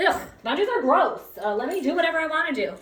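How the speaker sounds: hammed-up bratty complaint; mock whiny dog. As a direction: whine like a sulky dog. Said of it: "voicing dog's complaint".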